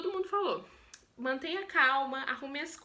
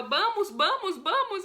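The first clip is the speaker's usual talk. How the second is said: louder, higher yells of vamos; urging shout; 6 semitones higher, 5 dB louder, slower than usual. Retold or imitated. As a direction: hurry them from the back seat. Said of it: "tudo de qualquer jeito". (gritando para apressar)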